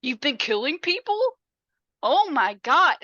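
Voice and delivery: disbelief tipping into telling her to shut up; shocked outburst. Retold or imitated. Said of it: "Selby is like".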